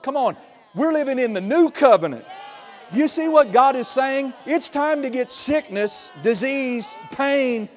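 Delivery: loud, high preaching shout; shouting preacher. Real, not acted. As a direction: preach it at full force. Preacher exhorts congregation.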